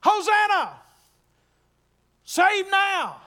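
high, loud joyful cry of praise. Retold or imitated. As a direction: shout it like a crowd hailing a king. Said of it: "they're crying out".

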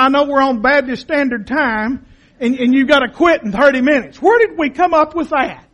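ordinary talk

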